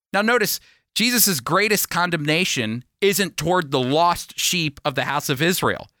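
normal talking voice

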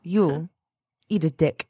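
put-on half-hearted Russian accent. Offering friends another attempt, low weak Russian accent. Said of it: "that's not good Russian either".

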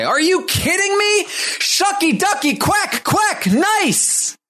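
high, slow, cartoonish cheer; Akbar's excited cheer. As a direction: squeal it like a hyped announcer. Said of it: "Akbar does say".

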